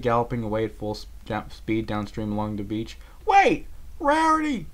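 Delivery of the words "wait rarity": high, loud call after her; shouting. A narrator's reading. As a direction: yell for her to stop. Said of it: "Applejack shouted out".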